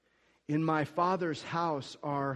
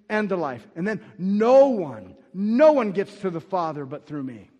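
full-force preaching; 3 semitones higher, 9 dB louder than usual. Acted. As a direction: pound out each claim to the room.

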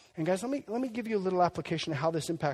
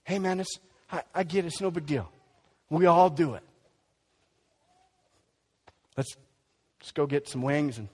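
casual buddy brushing off sin. Retold or imitated. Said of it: "this is how it goes culturally".

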